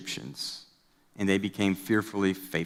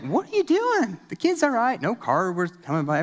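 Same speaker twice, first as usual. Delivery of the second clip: high-pitched, incredulous protest; mock incredulous; 10 semitones higher than usual. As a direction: scoff in a high, disbelieving voice. Younger self scoffing at parents.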